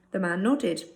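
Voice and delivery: ordinary delivery, nothing marked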